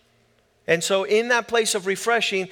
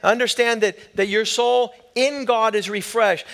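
usual speaking voice

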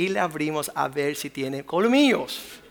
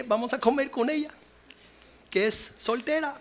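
higher, matter-of-fact youthful voice; naive young man; 6 semitones higher than usual. Retold or imitated. Young man answering the pastor about living with mother-in-law, naive higher voice. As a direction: play the clueless boyfriend, obvious to him. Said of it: "un jovencito".

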